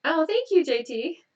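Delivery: light up at a viewer's name; bright sudden thank-you; delighted thanks. Real, not acted. Thanking a viewer who joined.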